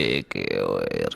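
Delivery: very low, soft and drawn-out. Pretend. Trying out the movement call as instructed, low heavy tone. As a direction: drop right down and stretch the word slowly. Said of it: "Harus dengan nada rendah".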